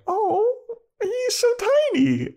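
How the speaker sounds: put-on high cooing baby talk. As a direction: coo it like over a puppy. Cooing at tiny demon.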